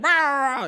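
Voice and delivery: high, loud mock dog growl; sudden drawn-out snarl of a small dog; imitated dog snarl. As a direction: lunge with a chihuahua's growl. Imitates dog snapping at him.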